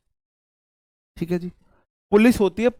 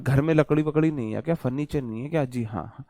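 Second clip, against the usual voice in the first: usual speaking voice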